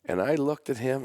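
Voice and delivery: ordinary delivery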